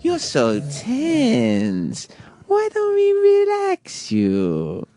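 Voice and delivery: slow, breathy-free sultry parody of the masseuse; mock seductive masseuse. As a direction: coo it slowly like a seductive masseuse. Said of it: "She goes".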